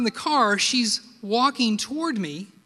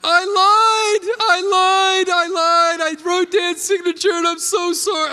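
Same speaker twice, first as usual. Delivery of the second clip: put-on a child sobbing out a confession, much higher and louder; bawling; 10 semitones higher, 8 dB louder than usual. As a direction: wail the confession through tears. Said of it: "I just start bawling like".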